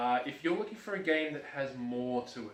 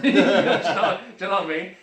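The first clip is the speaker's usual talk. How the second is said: loud laughing question; 6 semitones higher, 15 dB louder than usual. Real, not acted. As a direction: laugh it out loudly. Laughing at his own bias.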